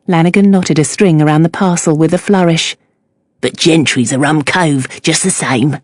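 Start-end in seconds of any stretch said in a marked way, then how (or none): 3.4-5.8 lowered admiring Cockney voice; Cockney accent. A narrator's reading. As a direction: praise him like a proud Cockney. Cockney fishmonger insists.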